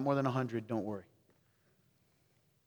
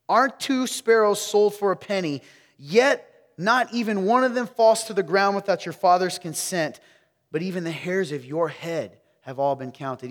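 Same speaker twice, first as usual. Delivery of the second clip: higher, louder reading of the verse; loud proclamation; 6 semitones higher, 9 dB louder than usual. Shown as a demonstration. Preacher rereading the verse to wake up the room, loud. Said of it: "Here we go".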